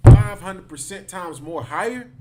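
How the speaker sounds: loud, slow, outraged question; five hundred percent said in loud disbelief; shocked disbelief. Real, not acted. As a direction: hit the number like you can't believe it. Reacting to incarceration statistic.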